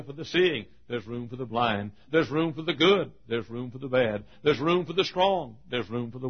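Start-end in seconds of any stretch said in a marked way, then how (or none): none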